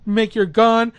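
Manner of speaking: ordinary talk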